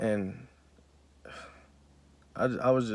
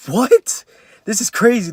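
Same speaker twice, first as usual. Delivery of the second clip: stunned disbelief; 11 semitones higher, 11 dB louder, slower than usual. Retold or imitated. Retold shock at seeing dad.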